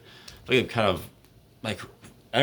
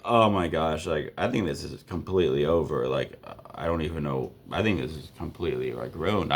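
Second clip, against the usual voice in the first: low, softer, deflated retelling; resigned dismay; 7 semitones lower, 4 dB quieter than usual. Retold or imitated. Guy realizing his date is completely over, resigned. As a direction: sink into mild mock despair.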